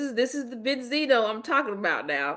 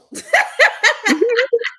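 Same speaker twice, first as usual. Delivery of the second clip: shrieking laugh; high shrieking cackle; 8 dB louder than usual. Real, not acted.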